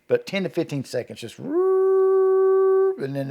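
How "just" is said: long, high siren-like wail held on one note; imitated distant scream. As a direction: rise into a high, sustained howl. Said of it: "starts out low, but it gets up real high and holds".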